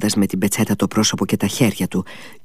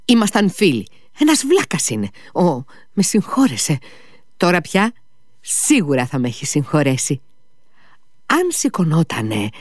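raised pitch, rambling explanation; excitable character catching himself mid-thought; lightly acted, high-pitched fluster; flustered, impulsive Mitya; 8 semitones higher than usual.

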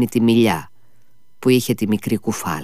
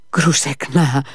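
raised call of the name; 4 semitones higher, slower than usual. Acted. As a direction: call her name out into the garden. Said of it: "φώναξε".

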